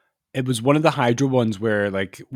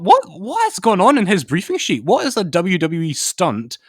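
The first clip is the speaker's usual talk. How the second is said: higher, louder, slowing on the names in disbelief; wondering aloud what's on that sheet; incredulous; 7 semitones higher, 4 dB louder than usual. Retold or imitated. Viewer baffled by the host's wrestling script, higher and incredulous.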